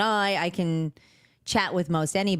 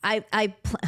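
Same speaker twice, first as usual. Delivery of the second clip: ordinary talk, nothing marked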